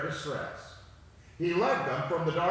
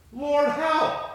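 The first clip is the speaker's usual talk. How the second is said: high, forceful shout to God; cry for help; 8 semitones higher, 7 dB louder than usual. A narrator's reading. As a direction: call out loudly for rescue. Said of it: "they cried in their trouble".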